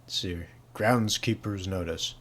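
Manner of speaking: normal talking voice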